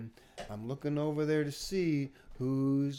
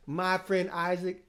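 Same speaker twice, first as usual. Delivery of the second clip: ordinary talk, nothing marked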